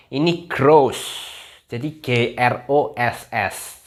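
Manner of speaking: "gross" said very slowly, then spelled G R O S S; slow spelled demo. Shown as a demonstration. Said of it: "bacanya bukan grup". (mengeja pelan untuk murid)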